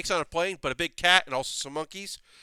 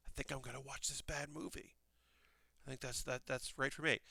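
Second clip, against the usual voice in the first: very soft, private aside; hushed thought, barely voiced; quiet inner thought; 15 dB quieter than usual. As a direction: murmur it as if thinking to yourself.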